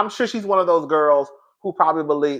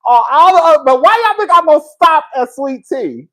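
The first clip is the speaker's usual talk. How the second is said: high, loud and laughing; half-shouting, egging the audience on; a worked-up defiant rant; 11 semitones higher, 8 dB louder than usual. Real, not acted.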